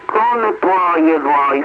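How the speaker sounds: normal talking voice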